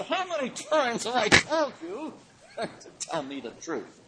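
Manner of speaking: high-pitched mock exasperation, dropping at the end; exasperated. King scolding the sarcastic prophet, mock exasperated.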